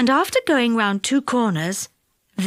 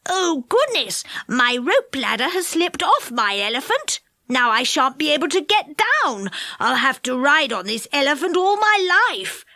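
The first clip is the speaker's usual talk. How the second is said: alarmed; 3 semitones higher than usual. A narrator's reading. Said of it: "Moonface was really alarmed".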